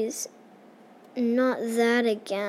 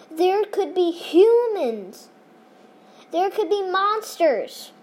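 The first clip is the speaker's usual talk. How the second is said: warning voice; 7 semitones higher, 6 dB louder than usual. Acted. Elders' warning in story.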